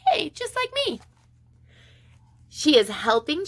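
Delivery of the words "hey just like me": delighted surprise. Real, not acted. Teacher finds a teacher grandma.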